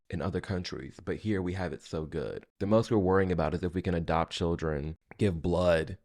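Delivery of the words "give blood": usual speaking voice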